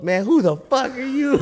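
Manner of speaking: high mock yell of a hothead; imitating angry yell. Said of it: "He's yelling".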